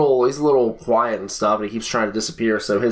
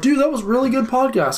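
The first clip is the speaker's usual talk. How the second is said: much higher, goofy fan voice; high mock fan voice; 14 semitones higher than usual. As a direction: squeak it like an eager fan. Imitating listeners.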